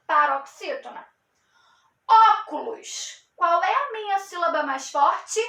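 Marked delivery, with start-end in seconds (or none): 2.1-3.3 "Óculos" slow, with a strong, stretched first "ó"; teacher sounding out "Óculos", first syllable punched; stressed first syllable. Shown as a demonstration. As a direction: lean hard on the "ó" of "Óculos". Shows which syllable is strongest.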